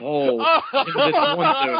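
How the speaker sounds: high, slow, giggling words; laughing while reading. Real, not acted. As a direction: break into laughter mid-line.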